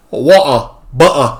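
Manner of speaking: "Water" and "Butter" with glottal stops for T; mimicked British glottal T. Viewer joking about UK speech, "Water", "Butter" without T. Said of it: "In the U K".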